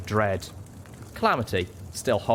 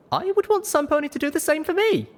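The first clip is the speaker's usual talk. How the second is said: high-pitched, emphatic character voice; earnest young heroine; 4 dB louder than usual. A narrator's reading. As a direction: insist on your principles, pitched high.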